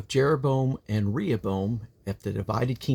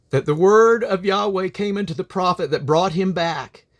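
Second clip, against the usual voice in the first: solemn, declaiming Bible reading, raised and louder; scripture reading; 6 semitones higher, 4 dB louder than usual. Acted. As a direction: proclaim the chapter from the pulpit.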